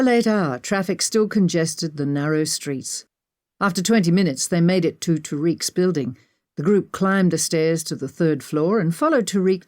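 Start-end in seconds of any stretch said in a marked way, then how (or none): none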